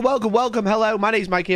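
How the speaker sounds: ordinary delivery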